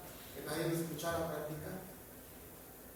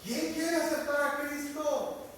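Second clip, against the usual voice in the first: higher, slow, pressing second altar call; insistent repeat; 7 semitones higher, 4 dB louder, slower than usual. Retold or imitated. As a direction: drag the question out, asking one more time. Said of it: "Lo pregunto una vez más".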